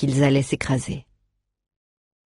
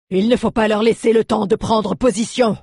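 high, raised commanding shout; yelled battle order; 6 semitones higher, 4 dB louder than usual. A narrator's reading. Galiad ordering the attack on enemy troops, shouting. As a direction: yell it out to the troops. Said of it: "hurla-t-il".

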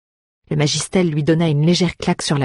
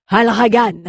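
loud, high rallying cry; exclamation; 7 semitones higher, 6 dB louder, slower than usual. A narrator's reading. Rescuer setting off with friend on his back, exclaiming. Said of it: "s'exclama Galiad".

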